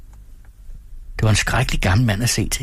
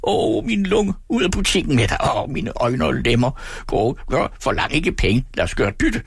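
put-on crazed old shopkeeper; 6 semitones higher than usual. A narrator's reading. Old man orders the boy out of his shop, wailing. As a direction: wail and order him out in one breath.

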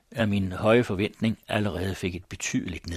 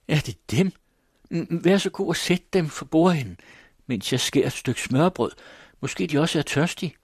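eager welcome; 7 semitones higher, 4 dB louder than usual. Acted. Mother bustles, welcoming guest.

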